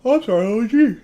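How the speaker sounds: surprised. Real, not acted. Reacting to a foul.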